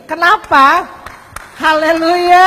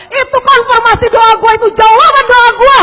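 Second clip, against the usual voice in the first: high, emphatic mock yell; retold excited cry; 7 semitones higher, 4 dB louder than usual. Preacher retells Ahok hearing the prophecy, excited mock yell. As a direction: imitate someone crying out in excitement. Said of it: "Ahok langsung teriak".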